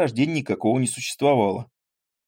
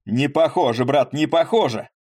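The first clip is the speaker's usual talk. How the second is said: louder, higher teasing cry; mocking shout; 4 semitones higher, 4 dB louder than usual. Landowner mocks Поль's coachman voice, shouting. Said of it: "закричал ему помещик".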